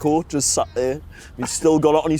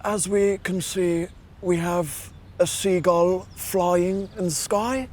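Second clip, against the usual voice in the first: higher, slow hushed nature narrator; David Attenborough; 5 semitones higher, slower than usual. Retold or imitated. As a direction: narrate the wilderness like a nature documentary. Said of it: "me David Attenborough one's the best".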